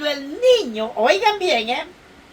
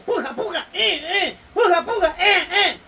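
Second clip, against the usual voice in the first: mock midwife urging; 4 semitones higher than usual. Acting out a midwife at birth.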